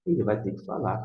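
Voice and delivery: usual speaking voice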